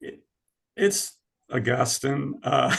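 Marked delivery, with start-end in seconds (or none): 1.5-2.4 Augustine said slowly as uh-GUS-tin. Shown as a demonstration. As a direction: stress the GUS in Augustine, short "tin" ending. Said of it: "How do you pronounce it?".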